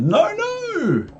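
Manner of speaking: high shrieking "No, no!"; frantic high little fish voice; panicky fish. Acted. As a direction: squeal it way up in alarm. Fish protests.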